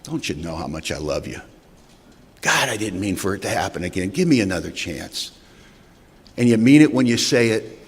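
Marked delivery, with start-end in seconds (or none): 0.0-5.3 hushed apology. Pretend. Drunk apologizing to his family, soft and pleading. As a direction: beg forgiveness under your breath. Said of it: "you say things like this".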